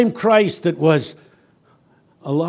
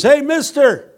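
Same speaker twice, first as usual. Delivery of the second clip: high, louder friendly call across the street; homeless man calling out; 10 semitones higher, 5 dB louder than usual. Retold or imitated. A homeless man offers the preacher his coffee, calling high and loud. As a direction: call out to a passerby, high and eager. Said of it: "he spots me and he says".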